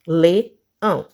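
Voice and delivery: "Leão" split into le, ão; a gap in the middle of "Leão"; syllable by syllable. Shown as a demonstration. Teacher showing two syllables, "Leão" broken in two. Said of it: "duas sílabas".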